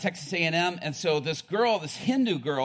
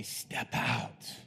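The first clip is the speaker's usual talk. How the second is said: hushed, breathy urging to step out; whispered "Step out" dropped low and soft; urged whisper; 7 semitones lower, 7 dB quieter than usual. Shown as a demonstration. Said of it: "I'm saying this to inspire you to say".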